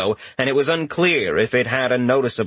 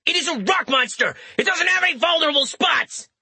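high-pitched shouted retort; shouting back; faster than usual. A narrator's reading. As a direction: yell back high across the battle. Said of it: "he did shout back".

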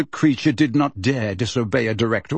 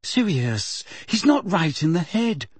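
pleading; 7 semitones higher than usual. A narrator's reading. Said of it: "said Hermione pleadingly".

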